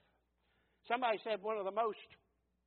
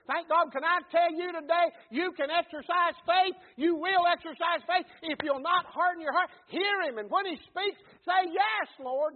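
louder, higher, driving revival preaching; preaching at full force; 9 semitones higher, 11 dB louder than usual. Acted. Pastor warning his congregation against hardened hearts, full-force preaching. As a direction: hammer each line home to the congregation. Preacher's climax about exercising faith.

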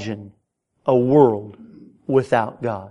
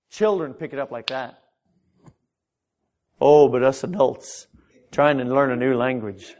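ordinary talk, nothing marked

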